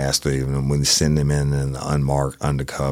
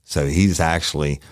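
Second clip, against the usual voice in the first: ordinary talk, nothing marked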